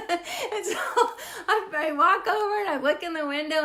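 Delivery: laughing through the words. Real, not acted. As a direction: giggle as you tell it. Laughing while retelling.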